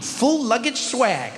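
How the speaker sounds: "full luggage swag" stretched out high and smug; swaggering brag. Retold or imitated. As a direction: drawl "full luggage swag" like a strutting star. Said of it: "I was like".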